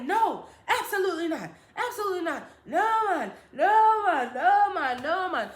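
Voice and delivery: a strict mother shutting it down; firm mom refusal. Retold or imitated.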